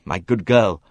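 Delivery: harsh and impatient. Acted. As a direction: snap at the girl. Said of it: "said Simon harshly".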